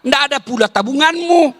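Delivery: ordinary talk, nothing marked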